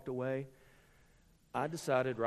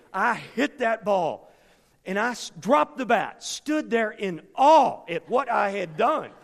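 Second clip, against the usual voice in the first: louder and higher, slow and proud; loud, slow triumph; 6 semitones higher, 12 dB louder, slower than usual. Retold or imitated. Preacher booming the big hit to the congregation. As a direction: let the hit ring out to the room.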